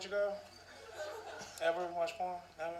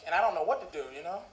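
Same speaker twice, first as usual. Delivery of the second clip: usual speaking voice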